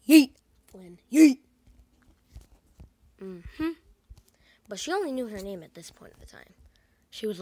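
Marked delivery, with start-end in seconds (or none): 0.1-1.4 loud, short burst on each yeet; shouted throw. Acted. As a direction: yell it as you fling someone out. Throwing Flynn out of there.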